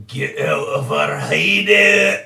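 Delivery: slow, snarled shout of a cornered goblin. Acted. Game master voicing the goblin as it shouts at Ferris. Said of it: "and he's like".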